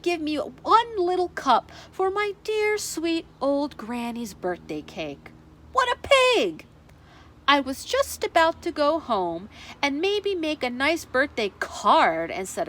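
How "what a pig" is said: high indignant exclamation. Acted. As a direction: scoff in disbelief. Wolf's indignant outburst.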